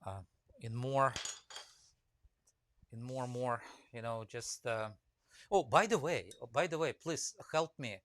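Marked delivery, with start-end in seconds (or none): none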